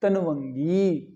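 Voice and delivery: तनवंगी slowly, final गी held long; correct form. Shown as a demonstration. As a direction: stretch तनवंगी as the answer. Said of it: "और क्या करना हमने?". (तनवंगी का शुद्ध, धीमा उच्चारण)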